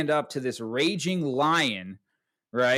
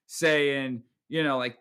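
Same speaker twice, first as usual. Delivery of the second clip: ordinary talk, nothing marked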